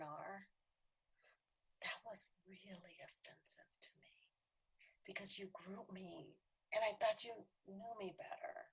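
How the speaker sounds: soft and careful, dropping to a whisper at the end; gentle confrontation. Retold or imitated. To Val, naming the hurt in a soft whisper. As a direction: tell a hard truth gently, barely above a whisper.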